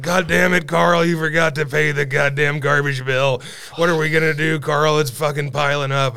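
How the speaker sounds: loud mock yell at husband; hammed-up nagging yell; nagging wife. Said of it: "She'd be like".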